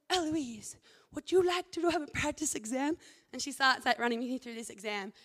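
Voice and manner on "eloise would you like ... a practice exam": imitating a tutor's Indian accent, louder and higher. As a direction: put on a light Indian accent. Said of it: "she has this Indian accent".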